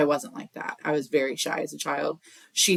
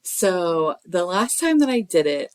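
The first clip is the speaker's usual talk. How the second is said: ordinary talk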